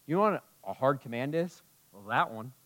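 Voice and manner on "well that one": normal talking voice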